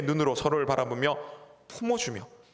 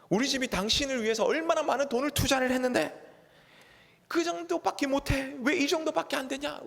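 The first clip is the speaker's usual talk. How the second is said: a wife's complaint rising much higher into shrieking reproach; nagging wife; 7 semitones higher than usual. Retold or imitated. Pastor voicing a wife reproaching her husband, high and nagging. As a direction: scold him, climbing ever higher. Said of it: "아내가 또 이렇게 얘기할 수 있습니다".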